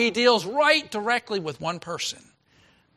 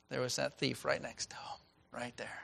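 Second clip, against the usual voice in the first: whisper; 5 semitones lower, 14 dB quieter than usual. Acted. Hushed aside about the thief.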